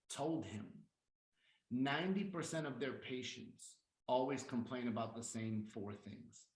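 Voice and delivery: soft and low, with measured pauses; confiding sales pitch. Shown as a demonstration. Presenter telling what doctors told Doctor Cohn, hushed and measured. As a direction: drop the volume and let each phrase hang. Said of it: "I'll say".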